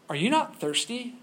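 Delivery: a hint of a storybook lion asking gently; lion's question. A narrator's reading. Lion addresses the lost girl.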